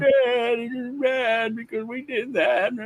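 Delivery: put-on high, slow little boy voice; child voice. Toddler Robert explaining to his dad, high slow child voice.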